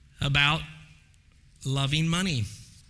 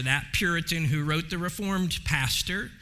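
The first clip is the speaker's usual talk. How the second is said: usual speaking voice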